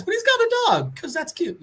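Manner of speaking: high-pitched, delighted gush; gushing over cuteness. Real, not acted. Defending a two-headed pet as cute, gushing. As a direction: coo it high, charmed by the dog.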